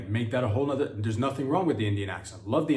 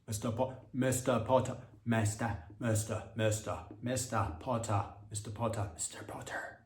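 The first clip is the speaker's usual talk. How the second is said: mock Nigerian accent fading softer to a whisper; put-on Nigerian voice trailing off to a breath; 5 dB quieter than usual. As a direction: repeat it, dying away into a whisper. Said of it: "my Nigerian accent".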